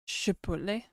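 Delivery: lightly put-on French question; French accent. Shown as a demonstration. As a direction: say it as a French word, softly. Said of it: "Her French accent brings all the boys".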